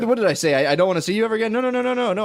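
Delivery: hammed-up desperate pleading; mock frantic denial. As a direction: take it all back in mock panic. Acted frantic retraction to girlfriend.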